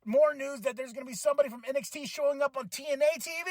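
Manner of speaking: ordinary talk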